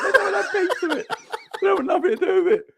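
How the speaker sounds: laughing through the words, high; giggling as he speaks. Real, not acted. Friend laughing about the bouncer's fear, laughing.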